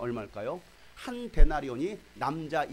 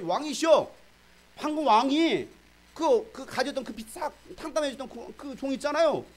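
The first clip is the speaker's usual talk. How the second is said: higher, louder urgent report; excited tattling; 9 semitones higher, 4 dB louder than usual. Retold or imitated. Servants rushing to tell the king, high and louder.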